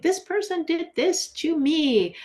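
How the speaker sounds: high-pitched, hammed-up grievance; mock victim complaint. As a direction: imitate someone playing the victim. Voices someone with a victim mentality.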